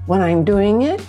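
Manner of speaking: ordinary talk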